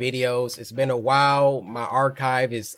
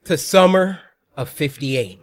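loud, high YouTuber greeting; hype intro; 4 semitones higher, 6 dB louder than usual. Acted. Greeting viewers at the start of the video, loud hype intro. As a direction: pump up the channel intro.

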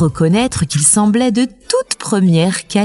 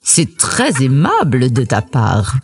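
légère voix de loup, grave et mielleuse; loup souriant; 7 semitones lower than usual. A narrator's reading. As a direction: souris en parlant, plus bas. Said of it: "sourit le méchant, méchant loup". (méchant loup faussement aimable)

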